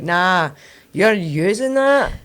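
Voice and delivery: high, slow incredulous drawl; disbelieving. Retold or imitated. Daughter scoffing at mum's excuse, incredulous.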